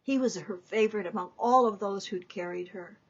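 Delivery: plain reading voice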